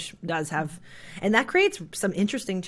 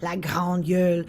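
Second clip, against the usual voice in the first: Saguenay French; 4 dB louder, slower than usual. Retold or imitated. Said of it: "quite far back in the throat".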